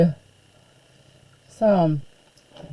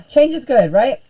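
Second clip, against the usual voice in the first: higher and louder, half-sarcastic cheer; wry self-persuasion; 4 semitones higher, 6 dB louder than usual. Real, not acted. Teacher grumbling about Facebook's changes, wry forced cheer. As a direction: talk yourself into it with a wry push. Sighing, forcing herself to accept.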